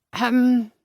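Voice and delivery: pointed throat-clearing cough; polite cough. Acted. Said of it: "Someone's cough".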